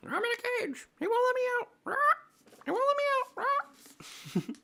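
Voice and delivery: very high caged parrot; bird voice. Acted. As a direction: squawk a complaint like a caged parrot. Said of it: "my bird voice".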